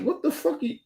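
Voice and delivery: stunned high-pitched what the fuck; mock-shocked outburst. Retelling shock at her behavior.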